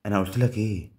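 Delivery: loud, triumphant question; excited gloat. Real, not acted. Streamer gloating to viewers after the win, loud triumphant. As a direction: crow it at the viewer.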